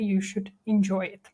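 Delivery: ordinary talk, nothing marked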